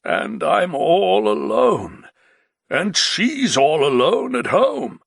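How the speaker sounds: slow, tearful lament; the Baron missing his wife, weeping; sad. A narrator's reading. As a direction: mourn being alone, drawn out and crying. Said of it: "The Baron said sadly".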